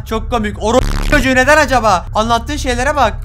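loud, high, indignant rant; sarcastic angry outburst. Acted. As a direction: build from sarcasm into a furious yell. Sarcastic outburst at game character.

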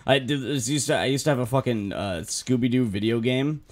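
ordinary delivery

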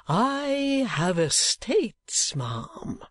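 high, very drawn-out boast; slow, swooping old suitor; eccentric old gentleman. Acted. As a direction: stretch it grandly, dropping on the last word. Old gentleman boasts of his property.

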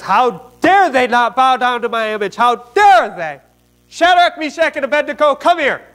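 acted royal outburst, high and loud; furious king. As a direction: summon them in a hammed-up rage. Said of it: "in his fury and his anger".

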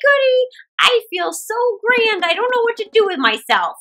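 put-on high, loud, chuckling old woman; dreamy happy old woman. Old woman plans how to spend her gold, gleeful. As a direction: daydream of riches with glee.

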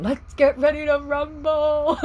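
high, loud, slow boxing announcer call; ring announcer. Retold or imitated. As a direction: belt it like a hammed-up fight announcer. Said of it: "I hear it again".

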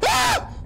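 very high, loud shriek; sudden terrified shriek; startled scream. Real, not acted. Gamer caught by another scare, shrieking high.

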